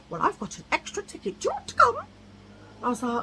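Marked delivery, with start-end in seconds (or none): 0.1-2.0 imitating eager woman. Francois inviting to concert.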